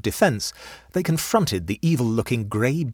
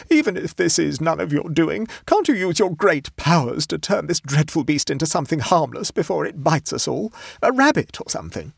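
high-pitched distressed pleading; tearful beseeching; 9 semitones higher, 4 dB louder than usual. A narrator's reading. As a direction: implore your nephew desperately, near tears. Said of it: "beseeched Missus Hoops tearfully".

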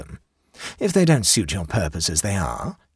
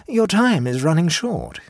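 slow, higher-pitched taunt; provocative; 7 semitones higher than usual. A narrator's reading. Young Duke goading a cabinet minister, provocative. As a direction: needle him with a lofty, drawn-out warning. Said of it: "he continued in a provocative strain".

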